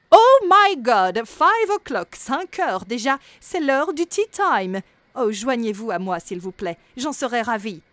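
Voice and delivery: plus haute, bien plus forte, lente, à l'anglaise; un gnome snob s'exclame pour le thé; gnome maniéré. Acted. Le gnome annonce l'heure du thé. (le gnome invite au thé, exclamation maniérée très forte)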